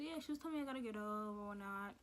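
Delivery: ordinary talk, nothing marked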